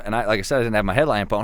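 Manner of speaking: normal talking voice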